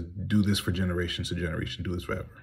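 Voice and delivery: ordinary talk, nothing marked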